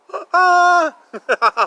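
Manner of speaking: a high burst of giggling; high giggle. Acted. Reader giggling along with the bouncing boy. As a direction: giggle high like a delighted child. Laugh after bouncing line.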